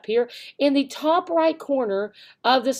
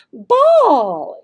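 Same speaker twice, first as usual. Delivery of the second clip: high, loud playful ball; varied voice; 4 semitones higher, 8 dB louder than usual. Shown as a demonstration. Teacher showing a lively voice, high and loud. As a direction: make the word pop to grab attention. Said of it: "Use a different voice, high voice".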